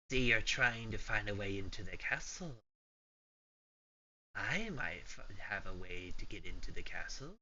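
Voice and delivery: lightly voiced crackling old woman. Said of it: "you hear a crackling voice".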